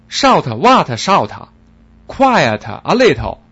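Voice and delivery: slow, very loud barked broken English. Acted. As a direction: shout him down. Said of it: "声音比他还大".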